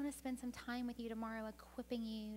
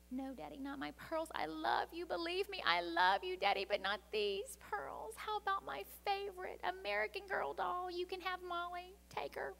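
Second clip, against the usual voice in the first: put-on higher, louder child's voice clinging to her pearls; pleading little girl; 7 semitones higher, 5 dB louder than usual. A narrator's reading. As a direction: plead like a small girl bargaining with Daddy.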